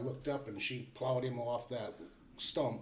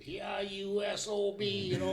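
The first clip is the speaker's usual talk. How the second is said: high, drawn-out mock yell, not much louder; retold holler; 8 semitones higher than usual. Hunter hollering at the bear that knocked him down, retold mock yell. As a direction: imitate cussing out a bear at half volume. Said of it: "hollered just as loud as I could".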